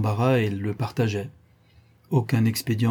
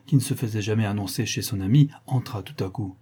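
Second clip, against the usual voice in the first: plain reading voice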